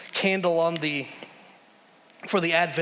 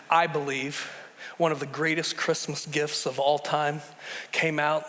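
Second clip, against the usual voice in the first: ordinary talk, nothing marked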